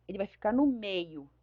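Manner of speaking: usual speaking voice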